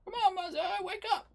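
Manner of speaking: high, loud cry to wake him; high, loud, insistent shout; shouted wake-up call. Acted. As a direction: yell him awake from far off. Friend shouts him awake.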